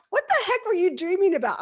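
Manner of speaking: loud, fast, high puzzled question; husband woken by the yell, fast and loud; startled husband. Retold or imitated. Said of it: "And Chris is like".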